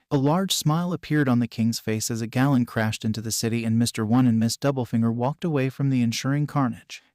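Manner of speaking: ordinary delivery, nothing marked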